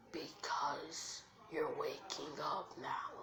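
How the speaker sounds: slow, soft, whispery beast; drawn-out whispered reply; fox creature. Acted. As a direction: draw it out in a hushed voice. Fox answers Naruto.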